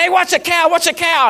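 high-pitched urgent call-out; strong enthusiasm. Retold or imitated. Rancher warning his helper about an escaping cow, strongly enthusiastic. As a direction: shout a quick retold warning. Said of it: "strong enthusiasm".

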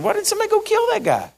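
put-on high and quick, blunt young David; David's blunt question. David bluntly asks the soldiers, high and fast. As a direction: rattle it off high, as if it's obvious. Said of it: "he just said".

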